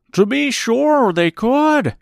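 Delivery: high, slow exclamation as a scarecrow; crying-out scarecrow. A narrator's reading. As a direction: cry it out in a high character voice. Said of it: "cried the Scarecrow".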